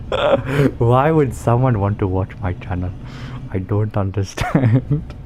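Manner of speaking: talking through a fit of laughter; baffled, trying to speak between laughs; laughing. Real, not acted. Laughing fit about own channel.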